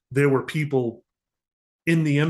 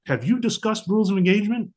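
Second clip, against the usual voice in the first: mocking; 8 semitones higher than usual. Mocking headquarters' question.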